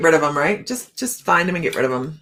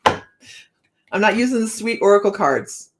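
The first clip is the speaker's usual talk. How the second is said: ordinary talk, nothing marked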